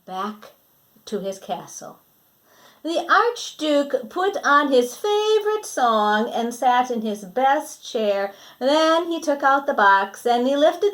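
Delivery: lively storybook reading voice; reading to children. Acted. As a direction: read it brightly to the kids. Storybook narration.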